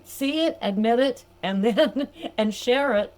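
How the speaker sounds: ordinary talk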